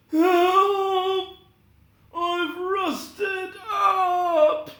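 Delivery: high, loud, very slow cry for help; stiff Tin Man crying out. Acted. The rusted Tin Man crying to Dorothy for help, high and loud. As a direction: holler while frozen stiff.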